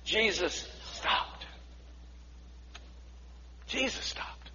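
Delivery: slow, near whispered; hushed. Shown as a demonstration. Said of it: "Did you say that back".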